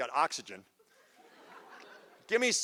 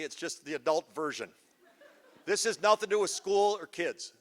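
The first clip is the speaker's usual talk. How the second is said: normal talking voice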